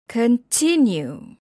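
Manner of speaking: slow "continue" with weight on TIN; stressed syllable. Shown as a demonstration. Teacher showing word stress, TIN stressed in "continue". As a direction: lean on the second syllable of "continue". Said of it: "stress is placed on a certain syllable".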